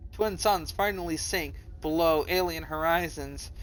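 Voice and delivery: ordinary talk, nothing marked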